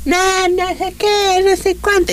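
high, slow mocking squeal of chatter; squeaky mimicry of arguing girls; mocking imitation. Imagining coworkers squabbling, high mocking voice. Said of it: "ya me imagino".

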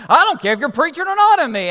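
high, loud irate retort; angry trucker. Acted. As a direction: shout him down as the mad trucker. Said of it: "he's all mad".

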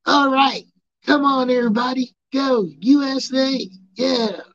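high, loud chanting fan voice; high loud imitation of a chanting wrestling fan; nerdy wrestling fan. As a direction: whip up the crowd like a geeky fan. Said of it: "super nerdy wrestling fans that be like".

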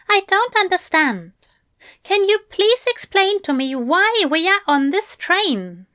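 high, pleading angel voice; exasperated angel. A narrator's reading. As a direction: demand an explanation, pitched well up. Angel demands an explanation.

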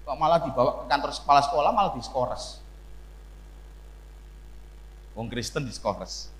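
normal talking voice

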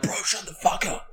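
voiceless imitation of a yell; mock hushed yell. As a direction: yell under your breath. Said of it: "trying to yell at him".

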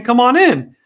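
sarcastic cop welcoming the crowd; mock welcoming cop. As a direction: play the overly friendly cop waving them in. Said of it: "they're saying".

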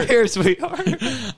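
laughing echo. Real, not acted.

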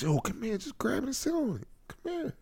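high, soft coaxing voice; mock come-on to a bunny. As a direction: coo it softly and high, in jest. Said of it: "something you say to one of the bunnies".

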